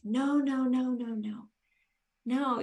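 high, loud mock-whiny no, no, no; stubborn refusal. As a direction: play your old stubborn self brushing him off. Refusing husband's plea to slow down.

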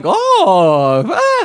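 rising wowed gasp; mock amazed gasp. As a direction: play the amazed audience. Audience amazed.

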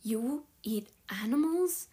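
whispered, slow, appalled question; like she ate toxic waste; horrified. Acted. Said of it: "in a tone ... eaten toxic waste".

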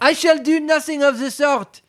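high, prim refusal; high mouse voice refusing help; huffy mouse. Acted. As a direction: turn her down with offended dignity. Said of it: "said the mouse".

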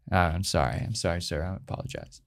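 low, fading retold apology; sheepish apology. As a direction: shrink down into a soft, contrite sorry. Retells sheepish apology after being calmed.

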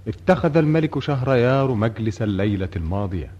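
ordinary talk

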